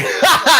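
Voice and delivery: high, loud mock laugh; imitated laugh. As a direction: burst of scoffing laughter as the friend. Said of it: "And Stephen's going".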